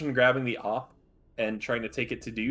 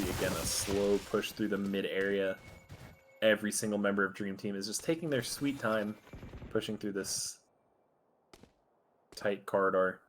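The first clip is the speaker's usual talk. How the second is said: esports caster; 2 semitones lower, 4 dB quieter than usual. Acted. Live play-by-play casting.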